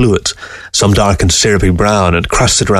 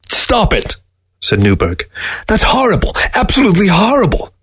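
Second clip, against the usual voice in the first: put-on upset old man; 11 semitones higher than usual. Old man begs fox stop.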